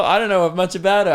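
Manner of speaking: ordinary talk, nothing marked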